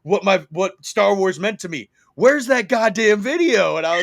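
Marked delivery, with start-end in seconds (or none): 2.2-3.8 mock-indignant yell, higher and louder; raised, impatient mock outburst; demanding. Texted demand from buddy, acted out.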